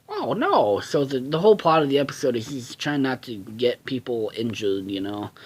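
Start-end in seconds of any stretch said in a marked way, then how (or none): 0.1-0.8 high-pitched mock dismay; acted cartoon "uh-oh" from a threatened employee. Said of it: "And then he's like".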